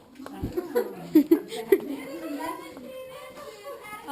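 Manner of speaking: burst of laughter. Real, not acted. Friend laughs at the sign.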